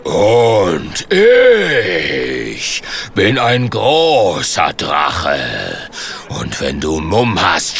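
langsames, tiefes Drachengebrüll; brülle gedehnt wie ein großer Drache; brüllender Drache. Acted. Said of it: "und brüllt". (tief und langsam dröhnender Drache)

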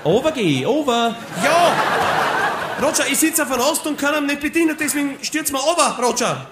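high, laughing radio chatter; over walkie talkie. Acted. Funkdialog im Absturz, hoch. (Funkspruch, hoch und lachend)

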